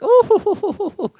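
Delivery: loud, very high hooting "oh"; high-pitched "ohoho" laugh. Retold or imitated. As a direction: let out a shrill falsetto "oh-ho-ho". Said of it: "It's that kind of voice".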